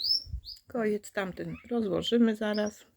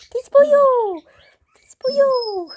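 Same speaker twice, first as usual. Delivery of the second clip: higher, louder playful yell; mock outrage; 11 dB louder than usual. Real, not acted. Owner yelling at the puppy in mock horror.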